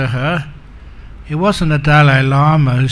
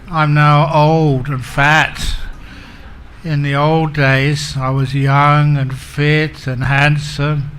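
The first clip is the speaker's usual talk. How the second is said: ordinary delivery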